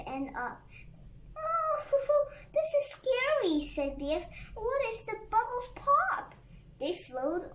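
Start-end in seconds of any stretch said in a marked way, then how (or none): 1.4-3.6 high, louder scared exclamation; pitched-up fright breaking into a laugh; acted fright. Biff scared floating up in bubble. 4.5-6.3 high, alarmed question; pitched-up acted panic; acted worry. Biff scared floating up in bubble.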